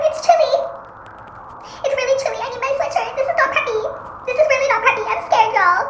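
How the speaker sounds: high-pitched play character voice; high cold voice. Acted. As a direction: squeak out the cold like a small creature.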